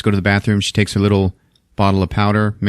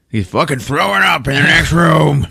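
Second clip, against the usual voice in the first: appalled, higher voice; disgusted; 7 semitones higher than usual. Retold or imitated. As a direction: recoil in disgust. Wife disgusted overhearing retching.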